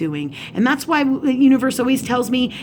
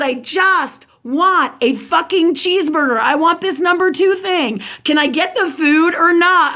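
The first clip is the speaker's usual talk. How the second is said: higher, emphatic retelling of an argument; heated re-enacted customer complaint; exasperated demand; 7 semitones higher, 4 dB louder than usual. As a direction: act out demanding your order, fed up. Retold drive-thru quarrel.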